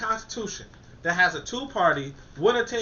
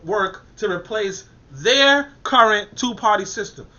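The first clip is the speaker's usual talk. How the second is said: slow, louder read, their pressed hard; stress on their; 6 dB louder, slower than usual. Shown as a demonstration. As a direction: drag it out and punch their. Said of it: "you gotta put an I R at the end".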